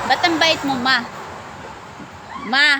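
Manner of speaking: exclaiming to mama. Real, not acted. Exclaims to mother during game.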